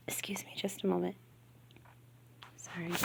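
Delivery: hushed apology mid-read; quick whispered excuse me; whispered aside. Real, not acted. Aside off the reading.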